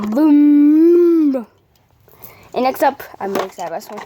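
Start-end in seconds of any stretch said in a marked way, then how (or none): 0.1-1.4 big booming shout; loud boom. Acted. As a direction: shout it out like an explosion. Showing off toy with sound.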